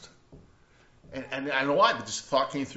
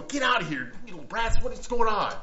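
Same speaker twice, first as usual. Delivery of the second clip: a louder, higher shooing of the kids, hammed up a little; mock scolding shout; 9 semitones higher, 7 dB louder than usual. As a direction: play the disciples telling kids to get lost. Disciples shooing the children away.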